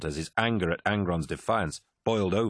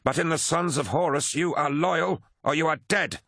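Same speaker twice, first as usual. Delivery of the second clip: raised, menacing snarl; 9 semitones higher than usual. A narrator's reading. Abaddon threatens Erebus over loyalty, snarling. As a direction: deliver the threat in a high snarl. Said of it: "snarled Abaddon".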